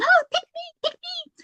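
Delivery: very high, squeaky little herb voice begging. Pretend. Thyme plant pleading with her to be picked, squeaky and high. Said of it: "kept kind of screaming at me and going".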